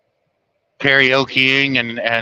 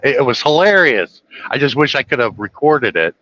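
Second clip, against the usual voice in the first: normal talking voice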